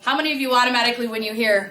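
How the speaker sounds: ordinary delivery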